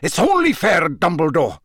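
hard, high, demanding tone; cold and ugly. A narrator's reading. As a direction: demand it with menace. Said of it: "He had dropped his unctuous tone".

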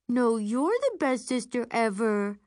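put-on high cutesy baby talk. A narrator's reading. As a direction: coo it like talking to a baby. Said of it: "in the baby voice".

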